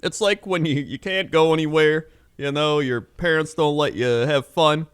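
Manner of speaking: put-on high, bright-eyed kids' TV announcer. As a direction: sell it like a Nickelodeon host talking to kids. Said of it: "They're like".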